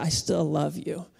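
soft, tender reassurance; a hushed, loving promise; tender God voice. Retold or imitated. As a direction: gently promise love. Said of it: "And he said".

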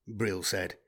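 ordinary delivery

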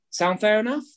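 "Sound fair enough?" with a high rising question tune; rising tonality. Shown as a demonstration. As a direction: lift "enough" up at the end. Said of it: "you have to say it in that tonality".

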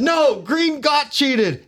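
put-on drunk insist. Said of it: "I'm smashed".

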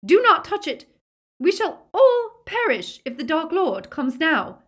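high, loud, urgent warning; frantic shrieked order; Bellatrix's shriek. A narrator's reading. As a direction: keep it piercing and alarmed. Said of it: "shrieked Bellatrix".

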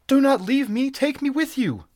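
very high, pleading cry; desperate wail. A narrator's reading. Captive woman begging to be taken along, wailing. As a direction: beg loudly, sustained high voice. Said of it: "she wailed".

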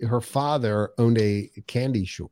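usual speaking voice

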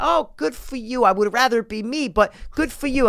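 put-on high, slow old lady voice. Old lady at the slots congratulating him, high and slow.

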